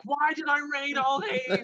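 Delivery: high put-on voice of exasperation; mock regretful cry. Host imagining regret after reading everything, high mock cry. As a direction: cry out as if the reading was all wasted. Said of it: "but I'll be like".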